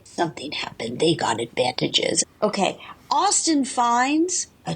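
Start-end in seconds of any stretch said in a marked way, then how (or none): 0.0-2.2 lower, mock-glum imitation of her complaint; mocking Emily. Mocking contestant Emily's gloomy complaint, voice dropped low. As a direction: drop the voice low and grumble her line. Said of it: "Emily again is like".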